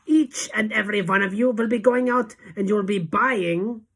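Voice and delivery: high, loud suspenseful order; commanding witch. A narrator's reading. Witch leader building to her order, loud commanding voice. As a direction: proclaim it loudly and leave it hanging.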